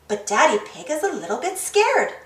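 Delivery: ordinary delivery, nothing marked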